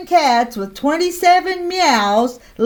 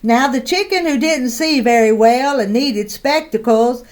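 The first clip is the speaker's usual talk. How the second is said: plain reading voice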